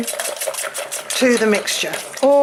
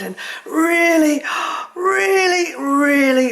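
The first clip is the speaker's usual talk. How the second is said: mock the dreamy TV chef, drawing it out; TV cookery presenter; 2 semitones higher, 4 dB louder than usual. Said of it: "I'm sounding like one of them women on the telly".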